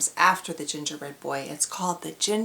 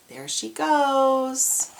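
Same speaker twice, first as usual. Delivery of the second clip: drawn out; 6 semitones higher, 3 dB louder, much slower than usual. Real, not acted. Excited aside to kids.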